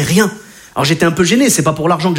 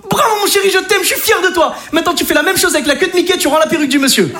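très aigu, fier, presque crié; féliciter son fils à pleine voix; papa fier exulte; 9 semitones higher than usual. Retold or imitated. (papa fier félicite son fils, très aigu et exalté)

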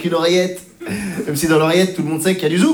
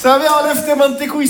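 energetic greeting; 8 semitones higher, slower than usual. Real, not acted. Comic greets crowd loudly.

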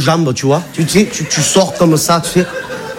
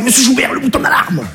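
high, strained banker voice; mock bank clerk pleading; banker's voice; 8 semitones higher than usual. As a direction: play the panicked banker, pitched up. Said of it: "il m'a fait".

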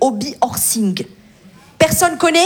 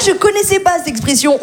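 ordinary delivery

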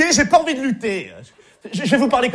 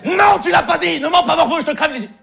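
mock outburst; 8 semitones higher, 4 dB louder than usual. Counselor blows up at kid.

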